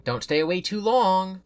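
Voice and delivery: lightly sad, asking her not to go; a little forlorn. Acted. Roxy to Cassie at the exit, mildly forlorn. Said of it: "sounding a little forlorn".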